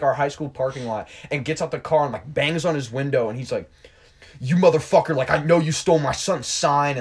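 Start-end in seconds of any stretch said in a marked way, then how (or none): 4.4-7.0 imitated yelling. Father confronts Trey over stolen sign, imitated yelling. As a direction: accuse loudly, acting out an irate father. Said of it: "was yelling at him".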